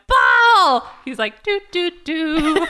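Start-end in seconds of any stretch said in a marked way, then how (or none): 0.1-0.9 loud, high mock shout; imitated yell. Woman yelling at unfazed driver, mock yell. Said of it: "she's still yelling at him". 1.4-2.7 slow, high carefree sing-song; mock unfazed. Imitating the chilled dad driving, mock unfazed. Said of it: "unfazed by anything".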